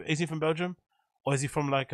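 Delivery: ordinary talk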